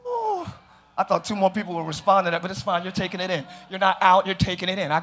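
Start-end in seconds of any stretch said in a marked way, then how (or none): 0.1-0.5 high, hushed falling "ooh"; soft high ooh. Real, not acted. Preacher savoring his own point, soft high ooh.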